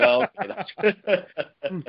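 very slow words through real laughter; drawn-out laughing oh okay; laughing realization. Real, not acted. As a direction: laugh it out as it dawns on you. Realizing the girl wore tights.

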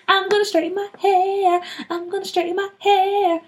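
high, giggly chant of excitement; gleeful sing-song. Acted. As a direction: chant it like a kid skipping off happily. Excited girl skipping down the hallway.

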